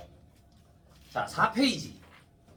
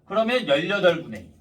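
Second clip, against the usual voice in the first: usual speaking voice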